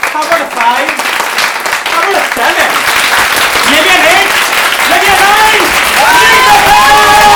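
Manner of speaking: climbing from loud to high shouting; rousing build-up. Real, not acted. Host pushes audience applause to the maximum, escalating shout.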